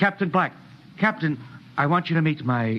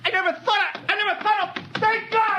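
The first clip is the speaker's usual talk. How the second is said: overjoyed; 13 semitones higher than usual. Acted. Lustig sees his grandparents' house.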